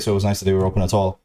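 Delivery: usual speaking voice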